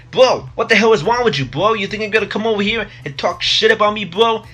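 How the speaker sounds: high, loud, indignant bro yell; hammed-up Guido shouting at a guy; angry Guido yelling. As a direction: get in his face, bro. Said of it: "He's like".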